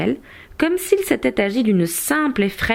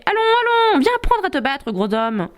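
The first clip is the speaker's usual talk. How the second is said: high-pitched mock shout that drops at the end; taunting shout; 9 semitones higher than usual. A narrator's reading. Nimble young knight goads the giant to fight, taunting shout. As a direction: call out a taunt, pitched well up at first. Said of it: "et lui criait".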